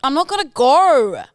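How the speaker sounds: high, exaggerated Australian vowels; broad Aussie take on a singer's refusal. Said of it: "the Aussie [name]".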